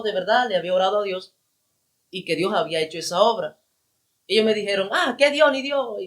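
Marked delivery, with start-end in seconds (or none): 4.9-6.0 mocking scoff. Friends mock his prayer claim.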